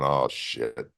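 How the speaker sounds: low, hushed oh shit under the breath; quiet dread. Retold or imitated. Wrestler to himself after poking Ming's eye, low hushed dread. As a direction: mutter it, realising the mistake.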